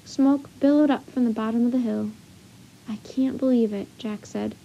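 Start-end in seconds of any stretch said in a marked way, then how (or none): none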